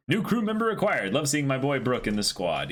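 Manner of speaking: bright, high chat-reading voice, pitched well up; upbeat message read. Acted. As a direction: read the donation out like a cheerful announcement.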